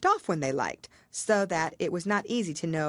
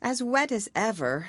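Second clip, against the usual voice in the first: melancholy; 6 semitones higher than usual. A narrator's reading. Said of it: "said Alice in a melancholy tone".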